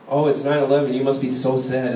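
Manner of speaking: usual speaking voice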